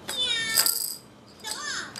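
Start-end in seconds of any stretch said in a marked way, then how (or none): 0.1-0.6 playful meow. Acted. Animal sound for baby.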